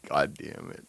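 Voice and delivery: usual speaking voice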